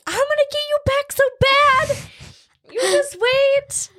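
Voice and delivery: very high, squealed mock threat; imitated revenge threat. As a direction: vow payback in a high put-on voice. Imitating scare victim vowing revenge.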